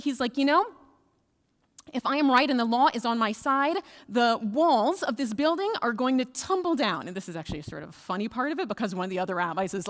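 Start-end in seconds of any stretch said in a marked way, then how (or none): 0.4-7.1 loud, high, exasperated declaration; frustrated. Acted. Frustrated rabbi calling on the walls to prove him right, loud exasperation. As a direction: raise your voice and insist. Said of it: "is getting really frustrated".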